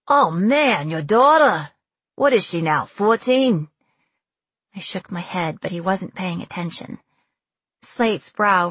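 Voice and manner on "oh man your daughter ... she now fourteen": loud, surprised Brooklyn officer; Brooklyn accent. A narrator's reading. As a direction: exclaim in broad Brooklyn, pitch swooping. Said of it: "The accent was pure Brooklyn".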